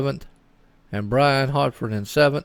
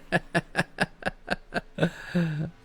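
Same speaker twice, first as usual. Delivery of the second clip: quiet laugh; 5 semitones higher, 7 dB quieter than usual. Real, not acted. Laughs at own slip.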